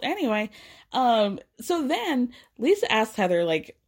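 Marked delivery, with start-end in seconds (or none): none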